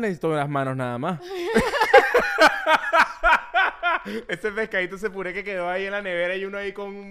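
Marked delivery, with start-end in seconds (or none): 1.2-4.2 high, helpless laughing fit; high laughter. Real, not acted. Laughs at his own joke.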